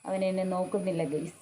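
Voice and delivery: a soft disappointed murmur; deflated aside. Real, not acted.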